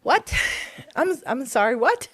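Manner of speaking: reliving her shocked reply; startled. Retold or imitated. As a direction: blurt it out caught off guard. Her stunned reply, retold.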